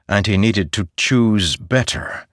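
ordinary delivery

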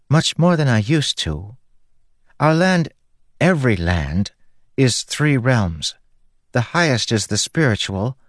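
high, airy spren voice, measured explaining; Syl the spren. A narrator's reading. Spren explaining the three realms to stranded humans, high and measured. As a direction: explain it in a light, high-pitched voice.

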